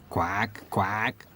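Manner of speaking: lightly quacked, rising; a gentle mother quack; mild mother duck quack. A narrator's reading. Mother duck greets her hatchlings, lightly quacked. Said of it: "said the mother".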